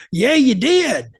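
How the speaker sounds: slow, insistent mock heckler retort; imitating an angry heckler. Heckler at the fence insisting he said it, mock angry. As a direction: shoot it back slow and stubborn.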